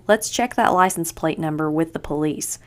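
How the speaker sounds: plain reading voice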